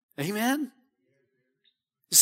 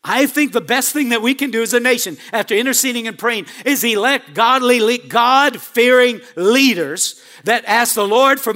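preaching at full force; 2 semitones higher, 6 dB louder than usual. Acted. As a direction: drive the point home to the congregation. Preacher repeats point, louder.

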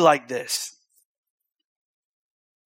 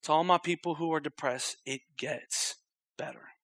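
much softer, fading tender promise; soft consolation; 7 dB quieter than usual. Real, not acted. Pastor comforting depressed listeners, soft and tender. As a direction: speak it gently to the hurting.

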